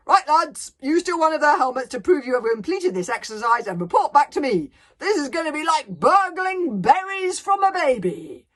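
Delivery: loud, high-energy commander's bellow; booming. Acted. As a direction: bark orders to the lads at full volume. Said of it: "boomed Gobber the Belch".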